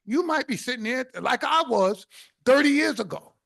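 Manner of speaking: ordinary talk, nothing marked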